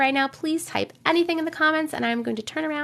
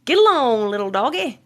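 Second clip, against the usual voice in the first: mock cowboy call; 4 dB louder than usual. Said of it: "Cowboys say that".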